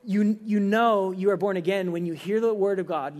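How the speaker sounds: usual speaking voice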